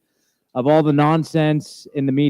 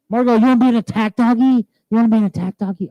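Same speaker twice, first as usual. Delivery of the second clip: talking to a dog; 7 semitones higher, faster than usual. Real, not acted. Talking to dog, baby talk.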